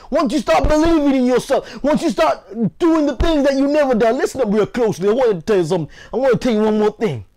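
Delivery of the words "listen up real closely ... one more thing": ordinary talk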